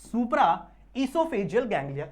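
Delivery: esophageal ganglia stretched very slow; teacher dictating the name slowly; slow dictation. Shown as a demonstration. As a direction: drag out esophageal for the class to write. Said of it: "उसे हम कहते हैं supra".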